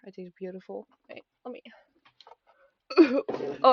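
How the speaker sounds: usual speaking voice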